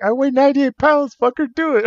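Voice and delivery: high, squeaky mock insistence; amused impression of a tiny wrestler egging him on; imitating pushy partner. Wrestler mimicking lightweight partner's dare, high and insistent.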